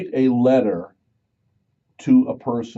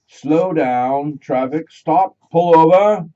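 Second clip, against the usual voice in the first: calling out; 5 semitones higher, 8 dB louder, much slower than usual. Pretend. Mock announcement to the nation.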